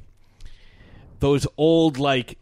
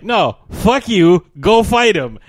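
louder, slow gruff brush-off; mock Godzilla; 3 semitones higher, 7 dB louder than usual. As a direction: bark it like a fed-up dad. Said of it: "Godzilla's like".

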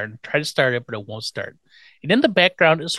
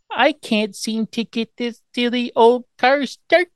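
put-on high, slow little-girl baby voice; put-on helpless baby voice; mock baby talk; 9 semitones higher, slower than usual. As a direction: imitate cutesy baby talk, drawn out and high. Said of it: "Stop the baby talk".

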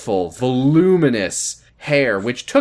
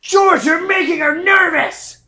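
high-pitched exasperated shout; 3 dB louder than usual. Acted. Mother scolds George for upsetting her daughter, shouting high. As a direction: shriek it as a protest. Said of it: "Anne shouted".

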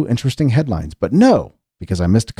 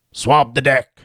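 a hammed-up pirate growl on swab the deck, pitched up; 5 semitones higher, slower than usual. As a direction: try out a mock pirate for the first time. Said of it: "I've never talked like a pirate before until just now".

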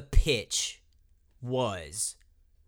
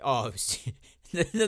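normal talking voice

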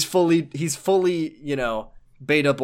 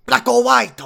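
excited yell; 8 semitones higher, 6 dB louder, slower than usual. Acted. Hyped voice continues.

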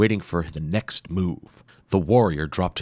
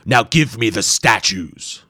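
demanding monster; 3 semitones higher, 6 dB louder than usual. Acted. Masked warrior demanding statues, slow and loud. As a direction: command like a horned beast. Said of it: "the warrior snarled".

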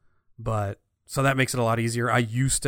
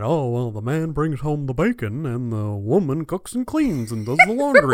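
put-on 1950s old man voice; 4 dB louder than usual. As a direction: play a fifties old-timer, hammy. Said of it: "my old man voice from the nineteen fifties".

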